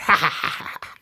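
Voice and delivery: breathy imitated villain laugh; mock laugh. Imitating the two wrestlers laughing, breathy mock laugh. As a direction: puff out a fake laugh, fading away. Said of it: "so they do the laugh".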